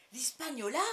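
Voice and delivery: loud, high, rising exclamation; startled shout, swooping upward; astonished cry. A narrator's reading. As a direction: cry it out in disbelief. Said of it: "s'écrie le docteur".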